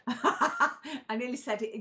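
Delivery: laughing through the words, slowed. Real, not acted. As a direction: giggle it out, a bit sheepish.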